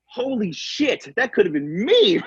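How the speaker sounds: high, slow, stunned realization; retold shock. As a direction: let it sink in slowly, half laughing at the close call. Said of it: "I was looking at that going".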